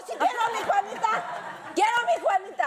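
put-on high-pitched, half-laughing childish demand; mock tantrum. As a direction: insist like a kid demanding a toy, playfully. Mock tantrum over a childhood doll. (berrinche fingido de niña)

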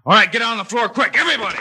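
shouted alarm over danger; urgent shout. Acted. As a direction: yell at everyone to get down. Dynamite thrown in, warning everyone.

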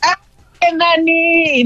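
high, drawn-out scolding; alarmed hushing. Real, not acted. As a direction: call out urgently to shut them up. Hushes the shouting winner.

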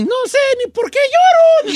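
high, tiny whimpered yes; squeaky tearful wife. Retold or imitated. Husband imitating his crying wife's tiny yes. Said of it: "Y entonces sí es muy".

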